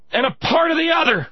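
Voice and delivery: ordinary talk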